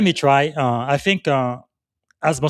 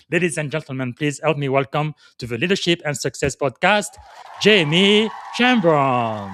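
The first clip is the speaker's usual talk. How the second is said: announcer intro; 4 semitones higher, 4 dB louder than usual. Acted. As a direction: build up to the name like a show announcer. Host formally introduces the guest.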